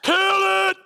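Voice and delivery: high, loud imitated screaming of a three-year-old; child screaming. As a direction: scream it like a kid standing on his seat.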